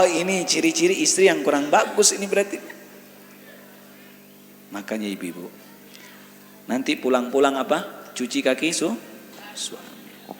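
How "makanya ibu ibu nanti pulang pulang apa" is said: ordinary delivery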